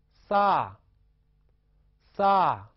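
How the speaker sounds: 撒 starts high and falls steeply; third tone. Shown as a demonstration. Teacher demonstrates third tone, 撒 high then falling. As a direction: hit 撒 high and loud, then drop it. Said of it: "加上三声声调符号".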